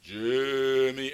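low, long-drawn mystery voice; eerie name-calling. Retold or imitated. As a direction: call the name out slowly from a hiding place. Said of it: "he would hear this voice".

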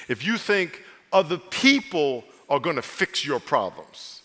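ordinary talk

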